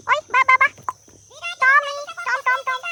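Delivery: high, loud call to the dog. Real, not acted. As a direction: call him over across the field. Calling the dog.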